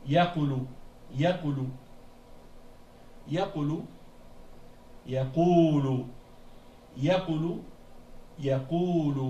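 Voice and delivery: "yaqulu" repeated with the u after q held long; "yaqulu", stretch the qu into quu each time. Shown as a demonstration. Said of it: "on l'entend quand on dit yaqulu".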